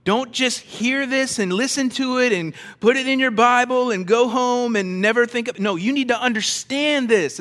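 urgent pleading tone. Retold or imitated. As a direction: press it home like Jesus would. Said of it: "is what Jesus is saying".

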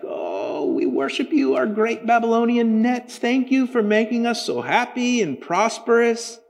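high, hammed-up mock praise to their nets; mocking Babylonian worshippers. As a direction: ham up a fawning prayer to a net. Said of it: "They're like".

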